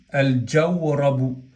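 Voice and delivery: "al jawrabu" slow, L sounded before the j; lam pronounced. Shown as a demonstration. Teacher demonstrating the article before jim, slowly pronounced lam. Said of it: "en este caso pronunciamos la lam de al".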